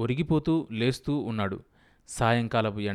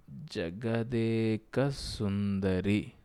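very slow, drawn-out syllable-by-syllable reading; piecing letters together; 4 dB quieter, much slower than usual. A narrator's reading. Husband laboriously reads the film banner, halting and slow. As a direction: sound it out like a halting reader. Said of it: "అక్షరాలు కలబ్బలుక్కొని చదివాడు".

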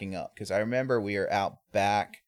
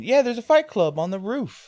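high, loud, happy hype; pumped up; 13 semitones higher, 8 dB louder than usual. Retold or imitated. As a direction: excitedly spread the news, imitating a hyped-up guy. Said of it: "He's all pumped up".

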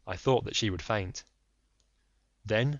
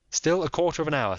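lightly voiced firm detective; polite but firm, a touch raised; Holmes; 7 semitones higher, 6 dB louder, faster than usual. Holmes pressing the lady, lightly voiced firm.

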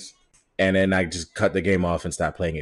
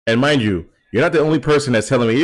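ordinary talk

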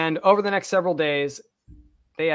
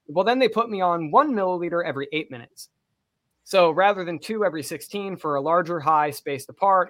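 ordinary talk

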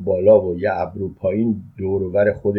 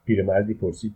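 ordinary delivery

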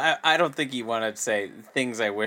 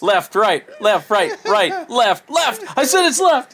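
rising higher, louder shouted back-and-forth; cartoon bickering; 11 semitones higher, 7 dB louder, slower than usual. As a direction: bark each word, climbing higher. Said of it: "when Bugs Bunny would, like, switch it up when you're having the argument".

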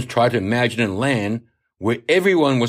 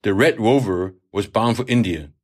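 ordinary delivery, nothing marked

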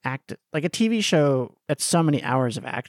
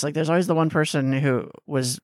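ordinary talk, nothing marked

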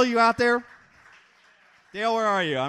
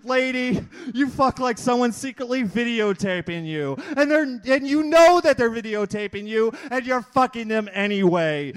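slam poetry performance; 3 semitones higher than usual. Acted. Comic poet reading his raunchy poem to the crowd, declaiming. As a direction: declaim each line to the crowd like a poet on stage.

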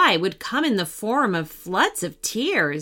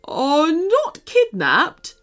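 slow, higher put-on teddy voice; correcting teddy; 6 semitones higher, slower than usual. A narrator's reading. Teddy Marshall corrects Jacob's word, pedantic. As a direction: correct them carefully, in character. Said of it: "corrected Marshall".

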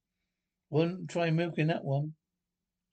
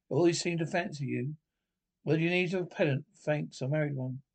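usual speaking voice

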